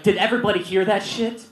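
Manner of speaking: high, loud mock-scandalised announcement; announcing to class. Teacher announcing to whole class.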